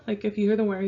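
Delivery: usual speaking voice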